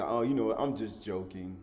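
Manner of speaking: low, casual mock excuse; offhand excuse. Mocker brushing off his own jibe, offhand. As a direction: shrug it off softly, imitating a joker.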